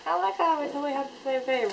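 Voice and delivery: high, gushing reenactment; excited fangirl quote. Retold or imitated. Acting out excited reply to namesake.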